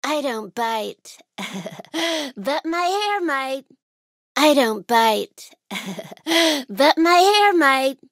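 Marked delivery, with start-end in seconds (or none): 1.4-2.3 short, low giggle; playful chuckle. Acted. Giggle inside a joke line. 5.7-6.6 short giggle, pitched low; playful chuckle. Acted. Giggle inside a joke line.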